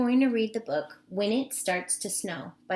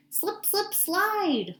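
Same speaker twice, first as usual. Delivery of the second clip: much higher, playful slip slip; sliding squeal; 10 semitones higher, slower than usual. Acted. Teacher voicing the otter sliding, playful and high. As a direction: let the voice slide up like an otter down a hill. Otter slides in read-aloud.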